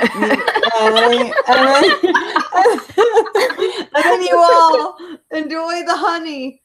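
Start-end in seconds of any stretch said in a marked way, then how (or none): 1.5-6.6 cracking up mid-sentence; laughing. Real, not acted. GM cracks up narrating.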